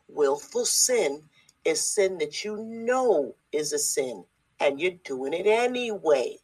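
slow, spelled-out definition; said slowly. Shown as a demonstration. Preacher defining willful sin for listeners, slowly. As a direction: lay it out word by word, slowly. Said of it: "I'm gonna say it slowly".

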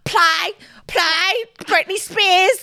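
high, slow Brummy request; imitating a drunk Birmingham friend; Brummy drunk mate. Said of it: "Brummy accent".

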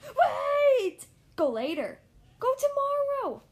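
high, loud cry to stop; hammed-up panic, words stretched out; desperate begging. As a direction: plead frantically for a delay. Gerald begs Piggy to delay.

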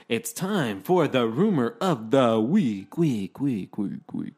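slow, loud announcer voice with echo; show intro. Pretend. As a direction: announce it big, echoing the last word. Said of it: "It's my turn to intro you".

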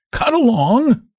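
high, jeering hunchback; mock the driver in a cracked, high voice; bossy, cracked-voiced taunting. A narrator's reading. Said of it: "in his cracked voice".

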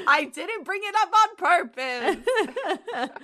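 loud, high, slow protest through real laughter; real laughing fit, words stretched out. Real, not acted. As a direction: wail it helplessly while cracking up. Protesting while cracking up.

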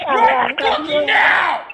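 slow, loud furious yell; furious shout. Real, not acted. Streamer yelling at the phone in real fury. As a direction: shout each word out in real rage.

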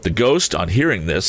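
ordinary delivery

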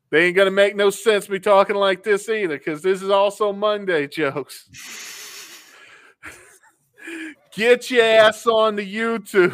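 light mock Southern accent; Southern boy accent. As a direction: play a good old country boy. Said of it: "talk with my old Southern boy accent".

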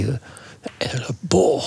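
slow, drawn-out unvoiced whisper. Partner spotting the bull close by, slow whisper. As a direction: stretch each word out under your breath.

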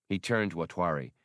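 usual speaking voice

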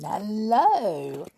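ordinary delivery

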